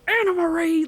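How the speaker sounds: high-pitched mock scolding; dad's exasperated telling-off, acted out; mad dad. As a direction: imitate an angry dad calling out a name. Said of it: "like, just mad".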